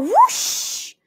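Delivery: high rushing whoosh; whoosh sound. Acted. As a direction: zoom past like wind. Chick running fast.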